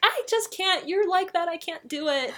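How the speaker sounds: high, emphatic mock protest; retold teen protest. Daughter's protest, acted by mother.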